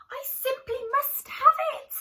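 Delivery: high, loud pleading squirrel cry; longing squirrel crying out for the cone; wistful cry. Acted. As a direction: wail it like a greedy squirrel who can't bear to miss out. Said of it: "he wistfully cried".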